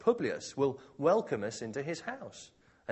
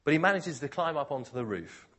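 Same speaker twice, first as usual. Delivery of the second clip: normal talking voice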